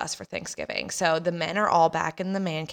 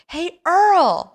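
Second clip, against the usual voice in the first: higher, louder call to wake someone; raised voice; 12 semitones higher, 11 dB louder than usual. Retold or imitated. Brother calling to a sleeping man, raised voice. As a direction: call out high and loud, imitating a brother. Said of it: "raises his voice".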